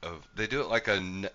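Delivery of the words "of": lightly voiced solemn nature narrator; a hint of hushed documentary narration; BBC wildlife documentary. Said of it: "like a wildlife documentary".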